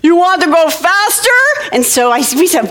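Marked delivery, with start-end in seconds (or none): 0.0-1.4 put-on high, teasing big-boy dare; playfully scary older boy; [name]. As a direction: goad the little kids with mock menace. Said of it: "just a tiny bit scary".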